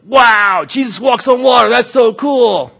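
loud, high put-on awe; mock amazement. Preacher parodying a wowed reader of Jesus' miracle, mock amazement. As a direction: gush loudly, drawing out the ending. Mocking naive reaction to the story.

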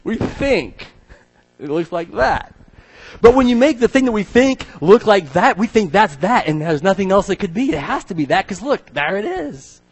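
high, loud mocking tone. Preacher mocking museum certainty, loud high scorn. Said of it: "Do you hear?".

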